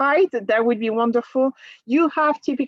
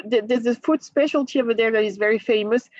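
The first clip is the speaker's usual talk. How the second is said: normal talking voice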